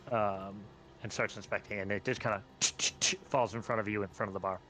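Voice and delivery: normal talking voice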